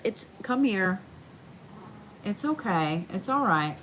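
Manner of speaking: gentle comfort to a frightened dog; soothing pet talk. Real, not acted. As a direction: reassure a scared dog. Comforting dog during thunder.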